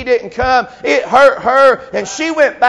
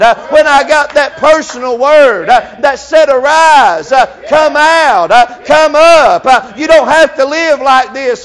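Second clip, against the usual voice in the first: louder, climbing preacher's cry; near-shouted sermon climax on "come up"; preaching at full force; 3 semitones higher, 5 dB louder than usual. Acted. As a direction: drive it home to the congregation.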